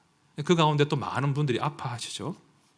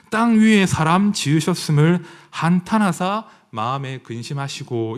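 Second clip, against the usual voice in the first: loud, measured Bible reading from the pulpit; a preacher declaiming scripture to the congregation; solemn scripture reading; 3 semitones higher, 7 dB louder than usual. Acted. Reads Genesis 6 aloud.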